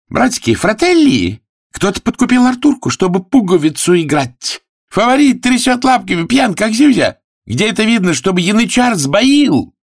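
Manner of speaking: very high, loud indignant cries; outraged harangue. Acted. Prostitute accuses Arthur before the sailors, high outraged shouting. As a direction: rouse the crowd in a high shout. Woman rouses crowd against Arthur.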